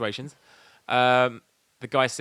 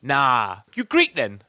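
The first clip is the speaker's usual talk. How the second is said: wannabe gangster; 5 dB louder, slower than usual. Retold or imitated. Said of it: "hard basic roadman voices".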